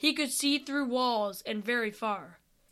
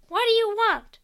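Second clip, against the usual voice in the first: high squeaky creature voice; 10 semitones higher, 5 dB louder than usual. Acted. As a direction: snap it out like a startled little creature. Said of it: "the creature asked".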